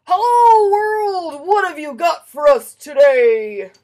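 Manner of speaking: big, loud, high booming hello to the world; hearty greeting. Acted. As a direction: call out to the whole world, slowly. Poppy greets world at window.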